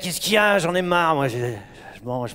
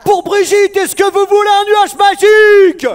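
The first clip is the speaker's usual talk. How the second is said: very high, loud storybook request; high theatrical plea; 14 dB louder, slower than usual. Pretend. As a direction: ask grandly in a high voice. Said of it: "il faut le demander mieux".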